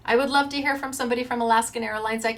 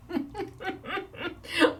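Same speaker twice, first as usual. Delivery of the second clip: laughing through tears; 7 semitones higher, 6 dB quieter than usual. Real, not acted. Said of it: "I'm trying not to cry".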